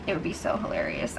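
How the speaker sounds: whispered, hushed glee; gleeful whisper. Real, not acted. Host imagining the bros' plan failing, whispered glee. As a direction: whisper it like a delicious secret. Gleeful aside about the blindside.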